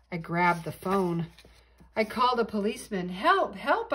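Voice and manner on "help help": higher, louder cry for help; urgent plea. A narrator's reading. Boy phoning a policeman in trouble, urgent plea. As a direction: call out for help, pitched up.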